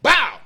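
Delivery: high loud smack sound; mock whip smack. Retelling mom's whipping, high loud whip smack. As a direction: bark out the hit.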